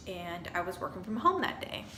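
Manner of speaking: ordinary talk, nothing marked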